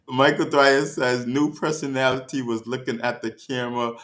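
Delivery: can't get through it for laughing; laughing while reading. Real, not acted. Streamer reads a viewer's comment, cracking up.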